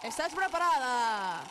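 loud game-show host with mock Spain accent; bad Spanish accent. Host welcoming contestant Luis like a Spanish game show, bad Spanish accent. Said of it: "te queda mal acento español".

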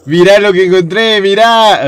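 loud, high excited boast; excited calling out. Real, not acted. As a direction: call out loudly to show a find. Shows off a find, calling out. (llamando a gritos, emocionado)

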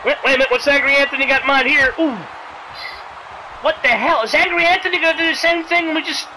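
ordinary talk, nothing marked

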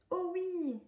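thrilled little ant; delighted cry. A narrator's reading. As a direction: squeal with excitement. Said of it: "s'écrie Nouni".

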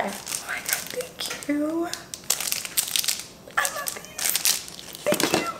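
tiny choked-up voice, barely there; about to cry. Real, not acted. Vlogger moved by Tokyo gifts, near tears. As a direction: squeak out thanks through happy tears, very softly. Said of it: "Why am I about to cry?".

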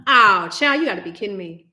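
ordinary talk, nothing marked

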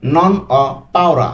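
"Non ho paura" spoken very slowly; slow teacher demo of "Non ho paura"; slow model phrase. Shown as a demonstration. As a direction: spell out "Non ho paura" for learners. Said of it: "তাহলে আমি ভয় করি না".

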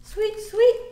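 bird call. A narrator's reading. Teacher voicing a bird in the story, high and chirpy. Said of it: "said Bird".

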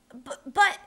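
loud, high frightened stammer. A narrator's reading. Boy stammering a protest to the captain, loud and scared. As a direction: trip over the word in fear. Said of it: "Matt stammered".

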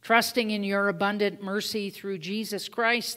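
ordinary talk, nothing marked

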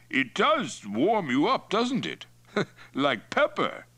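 high, slow, chuckling chief; Mongol chief Toktai, relishing. Acted. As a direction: savour the drink as the tipsy chief.

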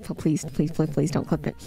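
comic deep begging of please; comic low begging voice. Pretend. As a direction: drop into a silly low voice and beg. Begs in a strange voice.

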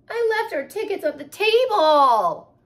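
high, loud dismay; distressed. Acted. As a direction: wail it out in despair. Mother in distress.

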